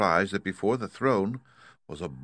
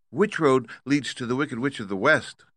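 put-on higher, girlish question; Dorothy voice; 6 semitones higher than usual. A narrator's reading. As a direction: ask earnestly as a girl. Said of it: "asked Dorothy".

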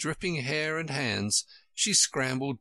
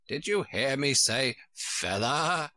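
slow, indignant sheep voice; cross sheep, voiced with restraint; cried angrily. A narrator's reading. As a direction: scold with mild anger, stretching the words. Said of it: "the sheep cried angrily".

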